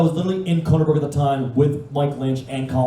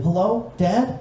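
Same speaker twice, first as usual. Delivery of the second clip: small, high voice on the phone; little girl's voice; 6 semitones higher, much slower than usual. Retold or imitated. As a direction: lightly mimic a timid daughter answering. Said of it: "The little voice comes on the phone".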